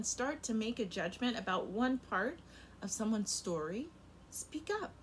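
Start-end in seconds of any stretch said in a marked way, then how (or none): none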